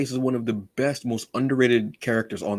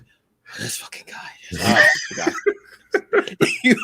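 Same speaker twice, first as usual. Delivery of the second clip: breathy hushed start bursting into a high laughing shout; muttered then shrieked Joker disgust; Joker impression; 5 dB louder, much slower than usual. A fan imitating Joker's disgust, hushed then high laughing shout. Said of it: "he's like".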